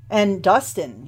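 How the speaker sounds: usual speaking voice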